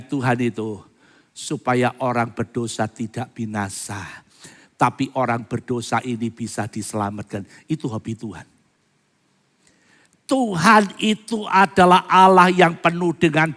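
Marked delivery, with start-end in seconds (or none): none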